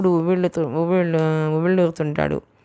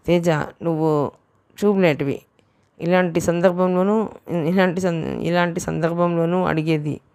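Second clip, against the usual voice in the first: usual speaking voice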